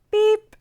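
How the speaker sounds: high, loud short beep; friendly truck beep. A narrator's reading. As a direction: toot brightly like a small horn. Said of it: "said the friendly little blue truck".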